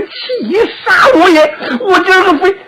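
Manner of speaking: high, furious cry of rage; furious outburst. Acted. Ma Dai, mocked by Zhang Fei, bursting out in fury. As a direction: explode with rage, voice shooting up. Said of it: "马岱也气乐了".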